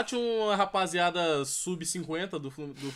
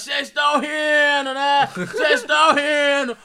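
slow, loud, higher taunting shout; mock tough guy taunt; 8 semitones higher, 9 dB louder, much slower than usual. Rival fans confronting the laughing friends, slow loud shout. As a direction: drag it out like a drunk fan picking a fight. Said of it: "os caras".

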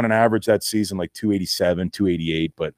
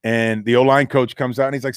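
ordinary delivery

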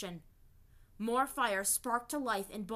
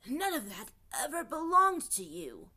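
loud, higher croaking spirit voice; 5 semitones higher, 6 dB louder than usual. Acted. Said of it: "croaked another voice".

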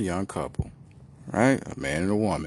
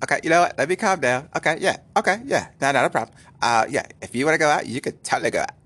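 lightly put-on girlfriend voice; mock-agreeable woman, pitched up at first; imitating women; 3 semitones higher, faster than usual. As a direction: pretend to calm down as the girlfriend. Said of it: "that thing that women do".

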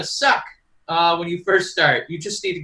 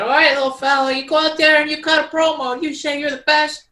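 high, far-off mock boss voice; lightly voiced backstage boss; coaching a wrestler; 8 semitones higher than usual.

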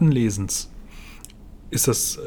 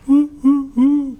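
mock hoot; 13 semitones higher, 5 dB louder, much slower than usual. Mock ghostly hooting.

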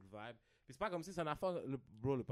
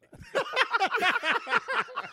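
high, loud burst of real laughter; a big laugh on hearing a guest's name; 20 dB louder than usual. Real, not acted. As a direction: crack up hard, much higher and louder.

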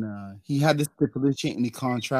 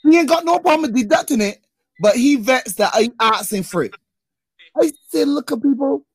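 indignant; 9 semitones higher, 6 dB louder than usual. Real, not acted. Indignant about deducted pay.

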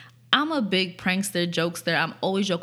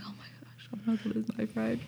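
very soft voiceless whisper; hushed reenacted alarm; whispered retold dread; 3 semitones higher, 9 dB quieter, faster than usual. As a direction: whisper it to yourself in disbelief. Recalls fearing friend would die.

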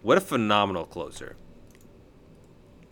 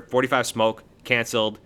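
ordinary talk

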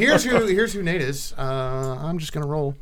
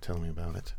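low, hushed murmur; in the ear; 10 semitones lower, 16 dB quieter, faster than usual. Acted. Prisoner leaning back to a guard's ear, low and hushed. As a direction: say it very softly, privately. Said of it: "he'll say that in my ear".

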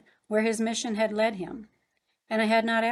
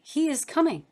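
higher-pitched, joyful exclamation; joyous cry; 7 semitones higher, 4 dB louder than usual. A narrator's reading. Narrator's sister cries out at her brother's news, joyously. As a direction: cry it out with joy. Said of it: "I cried out joyously".

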